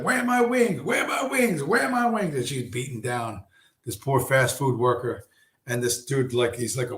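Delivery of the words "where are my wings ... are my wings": high, wailing repeated demand; hammed-up high-pitched tantrum; mock enraged customer. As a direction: play her wailing for her order mid-fight. Retold customer beating a worker.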